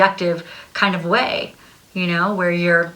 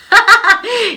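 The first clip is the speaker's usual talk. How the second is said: loud laugh; 7 dB louder than usual. Real, not acted. Bursts out laughing at own skit.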